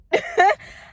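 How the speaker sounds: sudden high laugh; high burst of laughter. Real, not acted. Admitting God forgave her a lot, a sudden high laugh.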